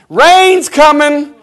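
slow, high, loud drawn-out call; slow warning cry. Acted. Preacher calling out that the rain is coming, slow and loud. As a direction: stretch it out like a warning from the boat.

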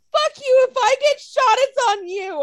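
high, loud, hammed-up drunken curse; mock tearful outburst. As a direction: imitate a weepy, dramatic drunk yelling. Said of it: "it just sounded like you were crying".

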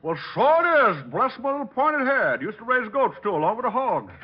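delighted country bumpkin; hillbilly put-on. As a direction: backwoods folksy glee. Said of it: "Mister Appleknocker".

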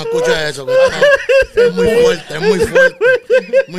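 squealing high through laughter; high-pitched laughing. Real, not acted. Laughing, telling chick not listen.

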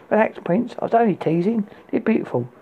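coy. A narrator's reading. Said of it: "she said, coyly".

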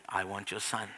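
very low, very soft, unmoved; hushed deep divine insistence; grave voice of God. Acted. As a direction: hold it low and quiet, unyielding. God repeats demand.

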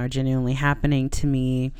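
normal talking voice

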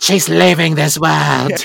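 slow, lightly voiced villainess; a hint of a menacing sorceress; Shadow Weaver voice. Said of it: "her Shadow Weaver voice".

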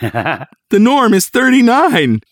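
high, drawn-out excited nurse; hammed-up excitement, stretched out. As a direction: announce it high and thrilled. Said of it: "And in an excited voice".

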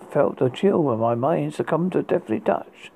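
ordinary delivery, nothing marked

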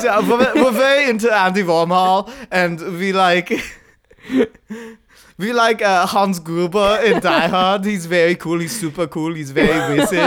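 put-on comic German hipster kid; mock German accent. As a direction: gush earnestly in a fake German accent. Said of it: "the crew was mostly German".

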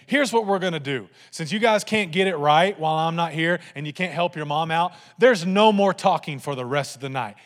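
loud, firm dad laying down the law; emphatic fatherly decree. Retold or imitated. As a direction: hand down the punishment to the kids. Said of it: "in my dad voice".

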